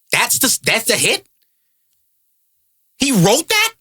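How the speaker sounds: disbelieving questions, climbing at the end; incredulous. Real, not acted. Comedian scoffing at a hit song, incredulous. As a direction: question it as if it cannot be true. Ridiculing the hit he just sang.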